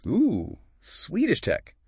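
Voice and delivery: high, drawn-out ooh of interest; intrigued ooh. Acted. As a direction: echo Swedish tech with delighted surprise. Co-host echoes with mock awe.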